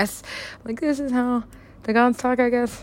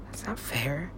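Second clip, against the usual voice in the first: hushed, breathy grumble; whisper; 3 semitones lower, 15 dB quieter, slower than usual. Real, not acted. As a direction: mutter it under your breath. Bitter aside dropped to whisper.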